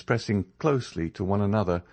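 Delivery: ordinary delivery, nothing marked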